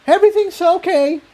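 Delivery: high, strained call of reassurance; a little hysterical shout. A narrator's reading. Incendia calls back to Jigsaw, high and a little hysterical. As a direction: shout it a bit too brightly, covering panic. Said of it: "shouted, a little hysterically".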